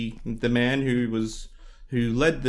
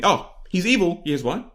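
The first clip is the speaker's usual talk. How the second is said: emphatic; 7 semitones higher than usual. Real, not acted. Mock accusation.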